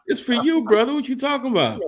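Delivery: high mock taunt aimed at the co-host; mock high tease. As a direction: pitch way up to needle him. Teasing co-host, voice jumps high.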